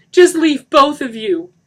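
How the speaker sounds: high, very loud order; crying out. A narrator's reading. Denny screaming at both, crying. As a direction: scream them out. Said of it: "Denny cried".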